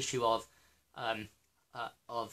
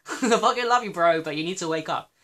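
laughing, much higher and louder; laughing loud outburst; 5 semitones higher, 9 dB louder than usual. Real, not acted. Laughing at Matt Blackburn while telling him to wake up, loud laughing shout.

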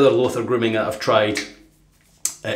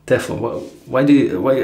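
ordinary talk, nothing marked

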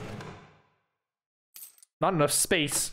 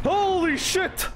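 high, slow, stunned exclamation; drawn-out shocked cry; 13 semitones higher, much slower than usual. Real, not acted. After the panic.

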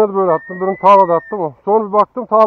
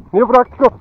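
usual speaking voice

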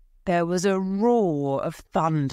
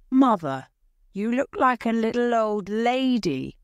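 shocked; 6 semitones higher than usual. A narrator's reading. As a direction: say it, shaken by her face. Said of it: "said Robert in a shocked voice".

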